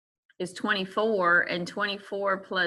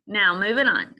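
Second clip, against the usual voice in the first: ordinary delivery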